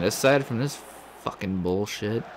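ordinary delivery

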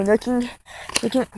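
normal talking voice

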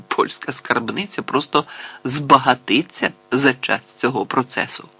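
ordinary delivery, nothing marked

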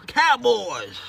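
ordinary talk

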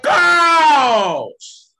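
high, loud proclaimed woe; thundering curse. Acted. Preacher quoting the Bible to sinners, loud shout. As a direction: call down the biblical curse, shouting. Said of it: "The Bible said".

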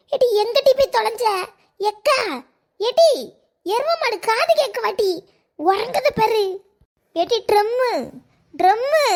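woman calling loudly for her sleeping sister. Acted. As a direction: yell across the house to wake her. Calling sister who is asleep.

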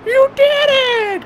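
very high, loud, drawn-out kiddie voice; cartoonish high-pitched congratulation; Jim Gaffigan. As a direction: squeal it slowly like a warped kid. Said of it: "high-pitched voice that he does all the time".

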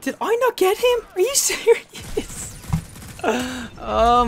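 high, slow, stretched disbelief; incredulous. Real, not acted. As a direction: drag out your disbelief. Misses the kill.

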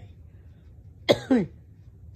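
rough chesty cough; hacking cough. Real, not acted. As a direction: cough it out hard. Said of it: "see what I mean?".

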